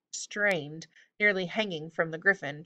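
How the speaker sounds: ordinary delivery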